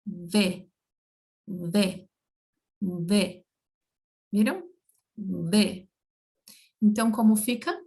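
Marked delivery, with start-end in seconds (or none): 0.0-3.5 V with upper teeth on the lower lip, repeated; Spanish V demo. Shown as a demonstration. Teacher drilling the Spanish V for students, repeated. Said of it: "assim, ó".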